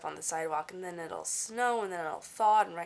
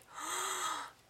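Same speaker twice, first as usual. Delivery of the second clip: shocked gasp; 12 semitones higher, 5 dB quieter than usual. Retold or imitated. Learning the headlights were off.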